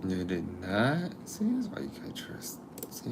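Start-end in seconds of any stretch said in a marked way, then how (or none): 1.2-3.1 whispered mock complaint. Real, not acted.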